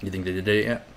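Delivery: soft, low, run-together question; too fast. Real, not acted. Co-host asks if viewers have liked it, too fast. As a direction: toss it off quickly and quietly. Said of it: "you said that too fast".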